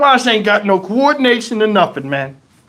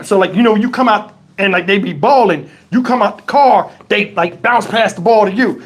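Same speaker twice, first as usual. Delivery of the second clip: ordinary talk, nothing marked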